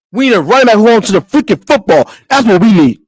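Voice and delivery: loud heated demand; loud frustrated fan demand; loud rant. Real, not acted. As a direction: yell it at the team. Ranting demand.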